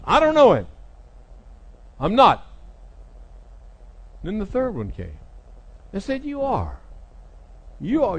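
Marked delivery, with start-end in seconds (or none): 0.1-2.5 loud, high-pitched outburst; angry denial. Acted. Peter denies knowing Jesus, acted. 6.2-8.2 high-pitched, repeated accusation; insistent accuser. Retold or imitated. Third accuser insists, acted.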